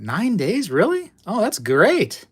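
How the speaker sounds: high, lifted, delighted surprise; pleasantly surprised. Real, not acted. Streamer hearing of nine-day shipping to Australia, pleasantly surprised. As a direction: react amazed, then stretch out the approval. Reacting to quick delivery to Australia.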